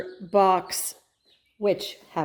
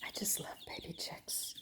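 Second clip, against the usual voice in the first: soft breathy whisper; 18 dB quieter than usual. Real, not acted. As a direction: whisper it tenderly. Unvoiced whisper.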